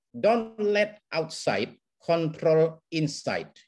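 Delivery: "Don't let outside control inside" spoken very slowly, each word weighed; slow emphatic motto. Shown as a demonstration. Counselor quoting a Satir principle to the audience, slow and weighty. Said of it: "ucapan satir yang penting".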